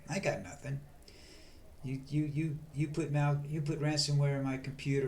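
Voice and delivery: usual speaking voice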